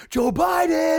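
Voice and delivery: high, loud, hammed-up yell; mock rally shout. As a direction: shout the name to whip up a crowd. Retelling Trump rallying the crowd.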